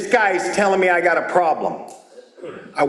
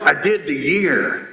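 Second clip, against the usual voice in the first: slow, lower-pitched repeat; tough guy repeating; 5 semitones lower than usual. Acted. Huge tattooed man repeating himself to the speaker, slow and low. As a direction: spell it out slowly, low.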